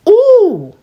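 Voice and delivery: high, loud squeal; a real gleeful ooh; thrilled ooh. Real, not acted. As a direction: squeal at the juicy detail. Reacting to court drama.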